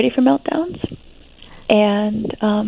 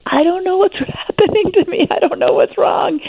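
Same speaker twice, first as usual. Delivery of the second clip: put-on much higher, half-laughing helpless teen voice; imitating a distressed teen; 10 semitones higher than usual. A meltdown teen admits she doesn't know what's wrong, voice pitched high and half-laughing. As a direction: sound like a kid baffled by her own tears, pitched way up. Quoting melting-down thirteen-year-olds.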